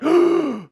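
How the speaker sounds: big, high, theatrical gasp; mock shocked gasp. Objector hearing the direct message, exaggerated shocked gasp. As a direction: gasp as if scandalized. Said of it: "some people are like".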